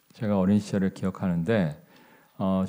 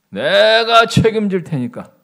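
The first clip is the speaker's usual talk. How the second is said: loud, high, drawn-out angry shout; angry outburst; 13 semitones higher, 12 dB louder, slower than usual. Retold or imitated. Stubborn old patient defies the doctor, angry shouting. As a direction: imitate a furious patient yelling at full volume. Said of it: "이러면서 막 화를 내시는 거예요".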